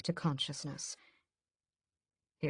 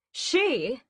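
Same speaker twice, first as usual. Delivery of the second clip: much higher, louder grand start; grandly; 11 semitones higher, 6 dB louder than usual. A narrator's reading. Mother announcing herself grandly to her sons. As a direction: pronounce it grandly. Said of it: "pronounces grandly".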